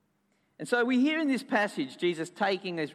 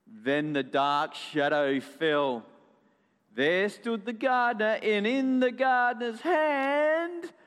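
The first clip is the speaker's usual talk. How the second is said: suspenseful story narration; 4 semitones higher, slower than usual. A narrator's reading. Storyteller reveals the gardener's hand, building suspense. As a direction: build the dread for children, stretching each phrase.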